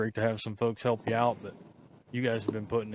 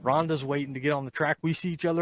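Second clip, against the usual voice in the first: excited; 5 semitones higher, 6 dB louder than usual. Real, not acted. Excited retelling of celebration.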